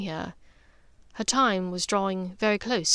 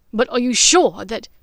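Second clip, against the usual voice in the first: urgent protest; 10 dB louder than usual. Acted. Draco protests, cut off.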